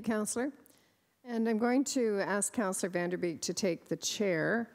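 ordinary talk, nothing marked

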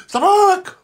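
high loud yell; shouting Suffolk. Acted. Host yelling Suffolk after slaving over the cooker, loud. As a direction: bellow the county name. Yells hushed between.

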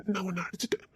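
whispered confession; hushed remorse; guilty whisper. Acted. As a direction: admit it softly, ashamed.

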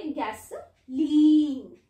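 गैसोलीन slow and loud, ending in a long leen; correct long ee. Shown as a demonstration. Teacher correcting a misreading, गैसोलीन with a drawn-out ee. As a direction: stretch the last syllable of गैसोलीन as ee, never as eye. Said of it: "इसे गैसोलाइन नहीं पढ़ेंगे".

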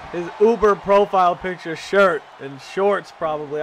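ordinary talk, nothing marked

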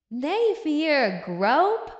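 drawn-out, rising mock shock; scandalized exclamation. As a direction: stretch the name out in disbelief. Reacts to queen groping husband.

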